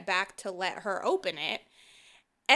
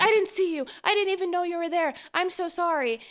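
higher and rushed, gushing apology; apologetic man; 8 semitones higher, faster than usual. Retold or imitated. Imitating the man who scared her, high and hurried. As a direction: tumble out a frantic sorry. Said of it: "he's like".

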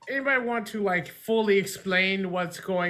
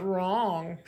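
usual speaking voice

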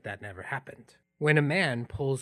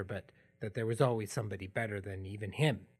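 ordinary talk

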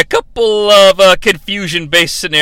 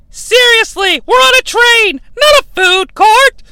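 shrill disbelieving passenger, much higher; exasperated high voice; 3 dB louder than usual. Acted. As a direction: squeak out the disbelief.

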